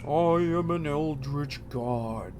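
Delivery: slow, grandiose otherworldly voice; eldritch god voice. Pretend. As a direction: intone it slowly like an ancient deity. Mimics the god's voice.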